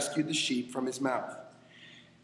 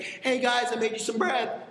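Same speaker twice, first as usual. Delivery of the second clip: bright, much higher little brother; little brother happily bringing food; little kid voice; 8 semitones higher than usual. Retold or imitated. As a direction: sound like an eager young kid. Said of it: "He's like".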